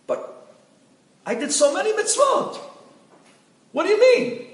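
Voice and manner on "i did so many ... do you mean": much higher and louder, pleading in panic; dead husband screaming at the heavenly court, frantic; desperate screaming. Acted. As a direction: plead for your life, voice cracking up high. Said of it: "started screaming to the Bedin".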